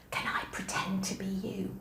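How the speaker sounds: soft voiceless whisper, a shy secret question. Retold or imitated. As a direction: whisper it like a nervous student asking a secret favour. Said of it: "poddled round to me ... and whispered".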